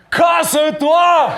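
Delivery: slow, stretched-out send-off shout; drawn-out dismissal. Acted. Battle rapper dismissing his opponent with all the RC team, drawn-out shout. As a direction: belt it out, dragging each syllable.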